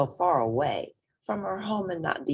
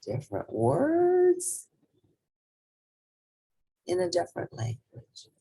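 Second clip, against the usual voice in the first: thoughtful emphasis; 4 semitones higher than usual. Acted. Reflective story ending.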